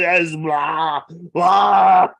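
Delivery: drawn-out blah blah; hammed-up vomit noise; mock retching. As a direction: imitate throwing up. Imitating vomiting.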